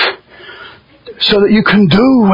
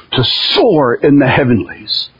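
normal talking voice

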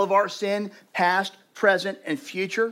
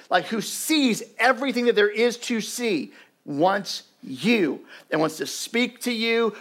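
louder, higher impassioned appeal; fervent preaching; 6 semitones higher, 5 dB louder than usual. Acted. Pastor inviting hearers to know Jesus, fervent preaching. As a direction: plead with the room, building. Altar call in full force.

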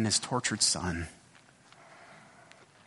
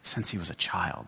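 pained father, voiced low; 7 semitones lower than usual. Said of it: "with great pain in his voice".

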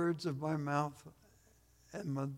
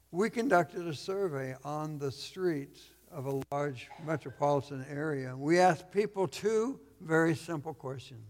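ordinary talk, nothing marked

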